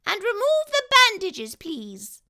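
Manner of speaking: very high, loud squeaky mouse voice; a tiny polite mouse dentist; little mouse speaking up. A narrator's reading.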